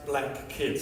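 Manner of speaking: normal talking voice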